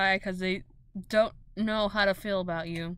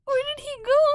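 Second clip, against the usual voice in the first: much higher, louder panicked asking; frantic laughing; 5 dB louder, faster than usual. Real, not acted. GM to players about the lost token, high frantic asking. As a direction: demand to know where he went.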